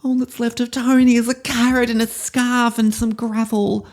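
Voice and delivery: very high, hammed-up mournful wail; over-the-top little-girl grief; grieving little girl. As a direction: lament the melted snowman like a heartbroken child. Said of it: "we go into the emotion".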